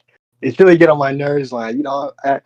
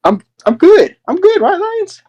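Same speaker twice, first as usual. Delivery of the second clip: strained fake cheer; 4 dB louder, slower than usual. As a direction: pitch climbing much higher with each word. Angry man insisting he's good.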